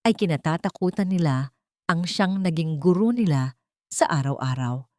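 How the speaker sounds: ordinary delivery, nothing marked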